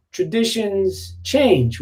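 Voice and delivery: ordinary talk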